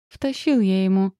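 mildly sepulchral, gloomy voice; a grim voice as if from beyond the grave. A narrator's reading. Husband admitting he hit the man, grim. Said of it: "раздался мрачный голос Антонова, как из загробного мира".

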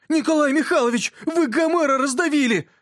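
high-pitched, mock-tragic boyish wail; wretched exclamation. A narrator's reading. Yurka to the teacher who shut the book, wretched exclamation. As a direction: cry out in aggrieved misery. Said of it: "воскликнул он несчастным голосом".

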